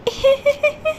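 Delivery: put-on high, eerie child's laugh; spooky high-pitched titter; ghostly giggle. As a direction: giggle like a haunting little ghost. Ghost girl haunting stepmother.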